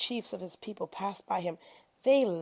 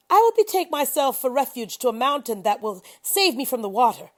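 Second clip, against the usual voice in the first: defiant reply; 5 semitones higher, 9 dB louder than usual. A narrator's reading. Son refuses, shouting back.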